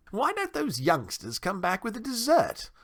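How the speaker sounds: high-pitched, fussy complaint; high voice, querulous question; impatient town mouse host. A narrator's reading. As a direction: complain in a high, lofty host's voice.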